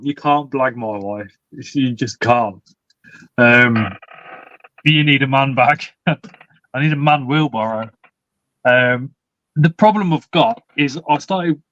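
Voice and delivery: ordinary talk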